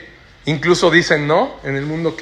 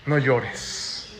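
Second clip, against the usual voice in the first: soft, low, gentle consolation; quiet reassuring command; tender comfort; 4 semitones lower, 7 dB quieter than usual. Acted. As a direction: hush her grief tenderly. Jesus comforting the grieving widow.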